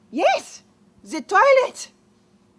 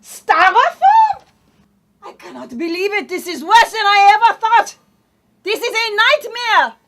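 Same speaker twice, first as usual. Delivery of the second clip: outraged shout; 5 semitones higher, 11 dB louder than usual. Acted. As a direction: shriek it in disgust. French lady outraged at styrofoam.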